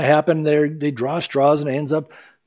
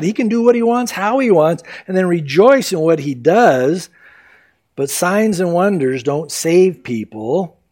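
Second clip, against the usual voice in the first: ordinary delivery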